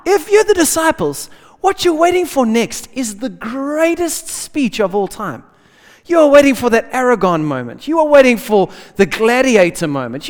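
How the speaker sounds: usual speaking voice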